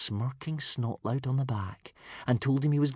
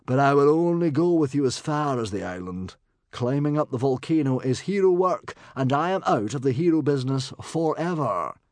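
raised, loud, firmly grudging character voice; reluctant bodyguard; 6 semitones higher, 6 dB louder than usual. A narrator's reading. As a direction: lay down your limit emphatically. Reluctant bodyguard sets limits.

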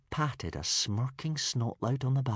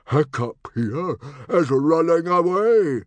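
loud, slow, raised angry chief's voice; shouting; 9 semitones higher, 10 dB louder, slower than usual. A narrator's reading. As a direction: shout the accusation for all to hear. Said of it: "shouted Stoic".

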